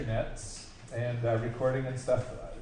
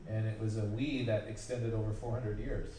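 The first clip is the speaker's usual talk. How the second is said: ordinary talk, nothing marked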